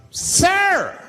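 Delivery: high, loud cry of alarm; freaked-out passenger. Acted. Passenger objecting to a smoker on the bus, freaked-out shout. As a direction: call out sharply in mock outrage. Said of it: "and people freaked out".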